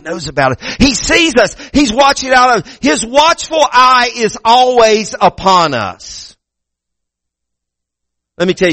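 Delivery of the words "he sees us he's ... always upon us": higher, rising preacher's cry; preaching climax. Real, not acted. Preacher proclaiming God's watchful eye to the church. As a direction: lift it up to a shout.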